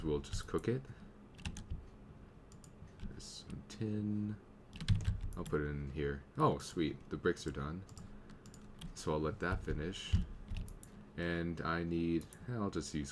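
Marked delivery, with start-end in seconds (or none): none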